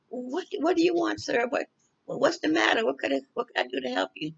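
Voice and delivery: high, soft, faltering plea to a stranger; trembling plea. Retold or imitated. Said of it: "I was shaking and trembling".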